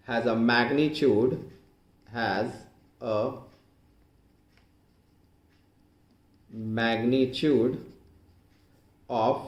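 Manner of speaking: very slow, word-by-word dictation. Shown as a demonstration. Dictates the definition while writing it. (धीरे-धीरे, रुक-रुक कर लिखवाते हुए)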